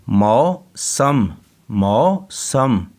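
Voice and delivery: मौसम drawn out very slowly; slow sounding out. Shown as a demonstration. Teacher slowly sounding out मौसम for the class. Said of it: "सीन मीम सम".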